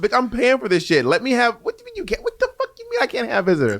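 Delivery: rising mock rant with a high squeak; mock outrage. Ranting at the hotel front desk, mock outraged.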